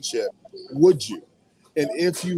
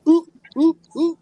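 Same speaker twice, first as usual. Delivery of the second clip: teasing ooh; 14 semitones higher, 5 dB louder than usual. Real, not acted. Reacting to a cohost's answer.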